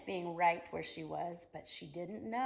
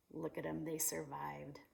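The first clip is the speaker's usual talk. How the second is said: hushed whisper; 2 semitones lower, 8 dB quieter than usual. Acted. Hushed storytelling.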